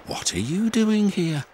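puzzled and curious; surprised question. A narrator's reading. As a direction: Thomas meeting Bulgy at the foundry. Thomas surprised.

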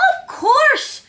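high hedgehog squeak; little creature's high, excited cry; delighted squeak. A narrator's reading. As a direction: squeak it as a sudden realisation. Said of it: "squeaked little Hedgehog".

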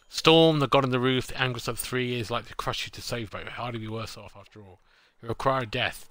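dramatic game-text narration, opening loud; booming storm narration; narrator read. A narrator's reading. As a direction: read the game's prose like a storyteller.

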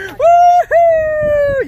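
high joyful whoop; excited whoop. Real, not acted. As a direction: cheer it out loud. Greeting family after finishing.

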